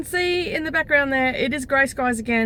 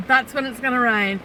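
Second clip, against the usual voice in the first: usual speaking voice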